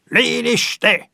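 high, loud barked order; roar. A narrator's reading. Guard bursting in to silence the singing, roaring. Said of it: "răcni el".

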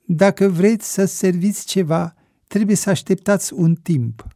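put-on waiter's Slavic-accented Romanian. A narrator's reading. Waiter warns guests of a wait, Slavic accent. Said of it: "într-o română cu accent slav".

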